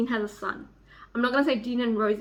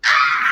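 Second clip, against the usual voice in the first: happy scream; 10 dB louder than usual. Real, not acted. Delighted outburst.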